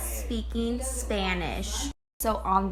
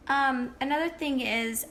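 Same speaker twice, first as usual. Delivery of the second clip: ordinary talk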